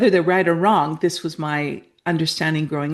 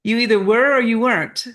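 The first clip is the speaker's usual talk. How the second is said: high, disbelieving repeat of Possibly; incredulous echo; 5 semitones higher, 4 dB louder than usual. Real, not acted. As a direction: playfully challenge the hedge. Challenges the guest's vague answer.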